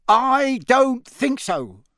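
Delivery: high, loud, slow contradiction; confident wizard. A narrator's reading. Amos Diggory disagreeing that culprit escaped, loud and confident. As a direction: draw out a firm disagreement.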